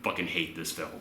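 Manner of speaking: low, soft, disgusted mutter; muttering to himself in disgust; fed-up mutter. Retold or imitated. Inner voice giving up on the film, low and soft. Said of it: "I went".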